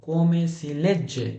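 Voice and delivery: very slow, drawn-out Italian phrase; stretched-out classroom enunciation; slow teaching repeat. Shown as a demonstration. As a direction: say it slowly for language learners. Teacher repeats phrase slowly for learners.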